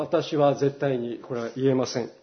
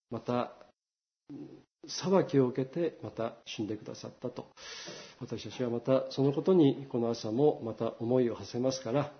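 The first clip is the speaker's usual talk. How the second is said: soft, reverent prayer voice; quiet sermon prayer; closing prayer; 5 semitones lower, 9 dB quieter than usual. Acted. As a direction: pray gently to God.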